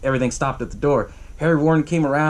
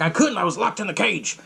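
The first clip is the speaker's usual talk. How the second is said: mock defensive protest; 5 semitones higher than usual. Accused man defends himself.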